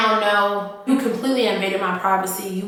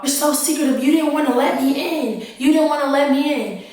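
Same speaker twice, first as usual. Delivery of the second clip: higher, imitating his angry accusation; pissed off boyfriend; 5 semitones higher than usual. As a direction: throw his grievance back, mock upset. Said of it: "he gets really pissed off".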